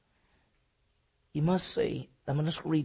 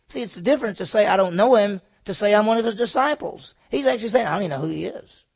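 louder preaching voice driving the point home; 5 semitones higher, 10 dB louder, faster than usual. Real, not acted. Preacher to congregation, preaching with force. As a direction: lean into it like a pulpit.

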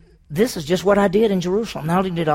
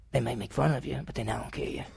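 dropped aside; 6 semitones lower, 11 dB quieter, faster than usual. Real, not acted. Aside about America.

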